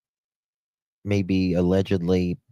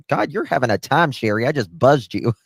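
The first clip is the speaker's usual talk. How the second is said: louder, higher, tickled outburst; amused exclamation; 6 semitones higher, 6 dB louder than usual. Real, not acted. Host to Sherry after buzzing her by mistake, amused outburst. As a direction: blurt it out, about to burst into laughing. Mistakenly buzzed a viewer, bursts laughing.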